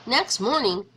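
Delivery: ordinary delivery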